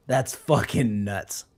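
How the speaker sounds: ordinary delivery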